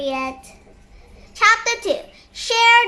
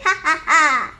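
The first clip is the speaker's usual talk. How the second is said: hammed-up story laugh; character laugh; 4 semitones lower than usual.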